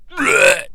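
retching "bleh" noise; mock gagging. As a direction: imitate a disgusted gag. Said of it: "He gags and vomit".

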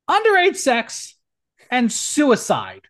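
high, loud disbelief; incredulous outrage. Real, not acted. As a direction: spit it out in outrage. Ranting outrage.

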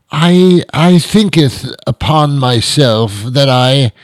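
slow, high, grandiose Shakespearean drawl; pompous Elizabethan diner. Acted. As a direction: order food with laboured, drawn-out pomp. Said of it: "Is that how they ordered food".

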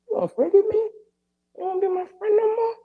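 put-on high, whiny kid pleading; a grown man whining like a small kid; little kid voice. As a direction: ask it like a hurt little child. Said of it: "I felt like a little kid".

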